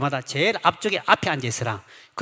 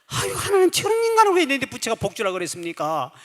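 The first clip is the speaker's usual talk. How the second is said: lament; 6 semitones higher than usual. Retold or imitated. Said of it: "통곡하며".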